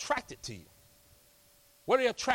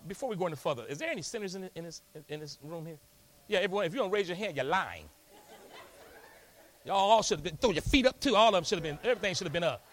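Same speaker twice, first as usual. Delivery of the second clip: ordinary talk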